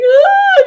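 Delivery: high, loud mock wail; sudden cry imitating someone bursting into sobs; acted-out overwhelmed "ah"; imitated sobbing outburst. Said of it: "she just started sobbing".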